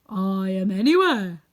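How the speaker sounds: solemn, loud, slow proclamation. A narrator's reading. Unseen wizard answers Dorothy, solemn and loud. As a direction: stretch it out like a booming unseen presence. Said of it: "answered the voice".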